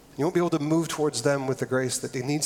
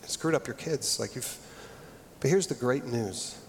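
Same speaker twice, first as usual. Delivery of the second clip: softer, fading to a near hush; reassuring; 3 semitones lower, 6 dB quieter than usual. Retold or imitated. Counselor Sandy reassuring the scared couple, soft and gentle. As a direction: break the news kindly and gently. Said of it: "with the most reassuring way".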